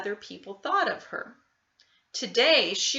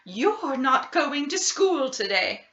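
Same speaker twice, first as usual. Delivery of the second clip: firm mother; 5 semitones higher, 4 dB louder than usual. Acted. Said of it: "her mother ordered".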